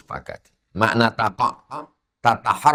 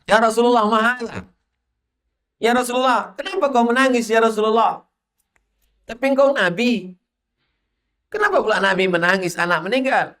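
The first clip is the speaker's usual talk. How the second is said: much higher, pleading questioner; Sa'ad asking; 13 semitones higher than usual. Retold or imitated. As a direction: beg to know why he weeps, high. (Sa'ad bertanya kepada Nabi, suara tinggi memohon)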